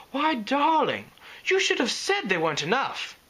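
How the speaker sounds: high, elegant lady voice; posh Rarity voice. Acted. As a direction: purr it like a grand lady.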